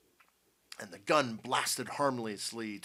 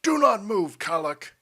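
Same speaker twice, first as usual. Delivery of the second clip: loud, forceful, high command; stern warning; 9 semitones higher, 12 dB louder than usual. A narrator's reading. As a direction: halt him at gunpoint. Potter warns general at gunpoint.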